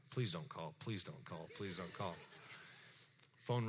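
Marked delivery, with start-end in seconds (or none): 0.1-2.2 soft, low repeated plea; pleading under breath. Retold or imitated. Praying the young man won't phone again, soft and low. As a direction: mutter the plea softly, over and over. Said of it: "and I'm thinking".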